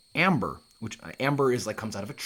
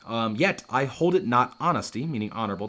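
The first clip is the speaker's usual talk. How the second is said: normal talking voice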